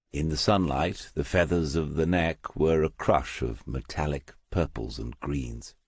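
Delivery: plain reading voice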